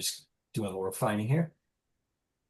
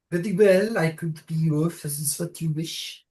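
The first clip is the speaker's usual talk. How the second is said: put-on high, loud Siouan accent; 6 semitones higher, 4 dB louder than usual. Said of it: "trying on my Siouan accent".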